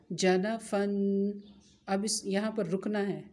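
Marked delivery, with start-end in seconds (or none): 0.1-1.4 जनफन read very slowly, each syllable stretched; drawn-out example word. Shown as a demonstration. Teacher shows pupils the word before stopping on it. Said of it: "ये लफ्ज़ था ना".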